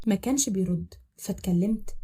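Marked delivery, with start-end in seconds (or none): none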